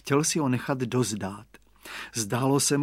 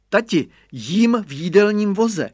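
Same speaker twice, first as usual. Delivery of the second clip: put-on higher, louder gleeful boy; child shouting; 7 semitones higher, 5 dB louder than usual. A narrator's reading. Boy shouting to father that he eats in the dining car, higher and louder. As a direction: shout proudly from the cart. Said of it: "křikl Radovan".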